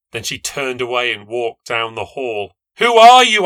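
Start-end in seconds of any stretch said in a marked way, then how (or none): 2.8-3.4 high, loud demanding shout. A narrator's reading. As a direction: yell at the girl behind the glass. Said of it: "I shouted".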